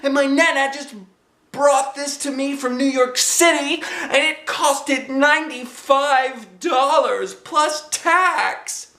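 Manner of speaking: very high slow outraged shout; furious little-girl yelling; upset shout. Acted. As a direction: scold her friend for ruining the dress. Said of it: "she hollered".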